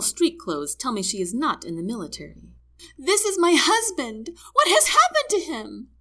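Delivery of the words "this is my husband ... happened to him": high, loud, hysterical shriek. A narrator's reading. Wife demands news of her husband from medic, shrieking. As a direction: demand answers in a frantic shriek. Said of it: "she shrieks".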